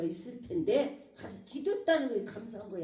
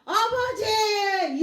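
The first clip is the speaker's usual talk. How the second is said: long, loud, high wail of prayer; crying out to God; 10 semitones higher, 8 dB louder, much slower than usual. Shown as a demonstration. Believer in hardship calls on the Father, crying out. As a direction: drag the call out at full voice. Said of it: "하나님께 부르짖고 울어야 되겠다".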